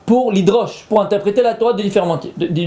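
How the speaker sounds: ordinary talk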